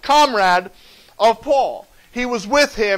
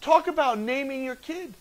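usual speaking voice

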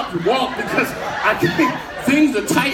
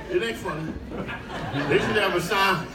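ordinary talk

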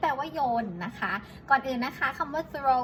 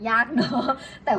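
loud, laughing admission; amused laughing; 6 dB louder than usual. Real, not acted. Teacher admits to learners it is hard, laughing. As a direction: say it through a laugh.

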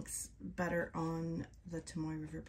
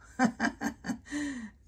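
1 embarrassed laugh; 7 semitones higher, 3 dB louder than usual. Real, not acted. Laughing at own pronunciation.

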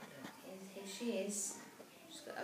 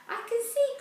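high, loud joyful cry; delighted cry; 17 dB louder than usual. Acted. Fairy seeing clearly in new glasses, joyful cry. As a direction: burst out with delight. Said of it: "cried Cassie".

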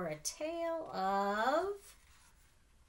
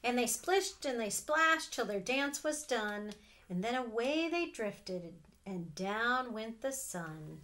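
winding-down story reading; 5 dB louder than usual. A narrator's reading. Aunt reading the ending to her nephews, winding down. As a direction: let it settle like a sunset. Aunt reads picture book aloud.

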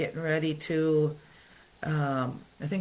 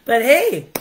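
high bursting laugh breaking into a loud "but hey"; real laughter; 10 semitones higher, 13 dB louder than usual. Real, not acted. Laughing at her own joke about the smell of boiled eggs in the car, high bursting laugh.

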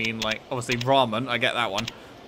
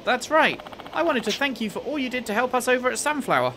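high-pitched headmistress character voice; former headmistress; 10 semitones higher than usual. Acted. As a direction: play a grateful woman, pitched up. Voices former headmistress in game dialogue.

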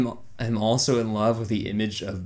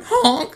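high, loud honk; imitated clown honk; 5 dB louder than usual. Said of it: "it's going".

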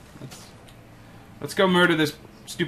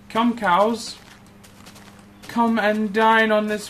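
high, loud, slow lordly invitation; mock grand summons; 8 semitones higher, 6 dB louder, slower than usual. Player inviting cows to dine, hammed-up grandeur. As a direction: summon the cows like a host at a banquet.

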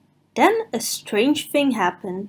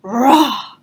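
roar; 4 dB louder than usual. Acted. Rock roars.

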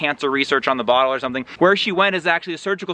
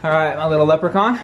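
normal talking voice

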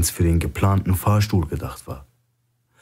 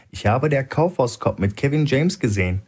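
chatty tone; 6 semitones higher than usual. A narrator's reading. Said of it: "sagte er im Plauderton".

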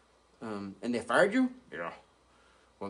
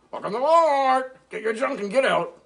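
high loud puppet snapping at shoppers; rude greeter voice; 11 semitones higher, 8 dB louder than usual. Retold or imitated. Dog retells the rude Walmart greeting, high and loud. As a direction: bark it at customers. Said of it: "Then I said".

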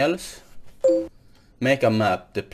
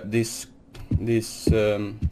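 ordinary talk, nothing marked

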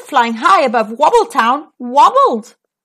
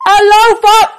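very loud, high shout; top-of-voice shout; 10 semitones higher, 9 dB louder, faster than usual. Acted. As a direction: bellow as hard as possible. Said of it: "shouting at the very top of his voice".

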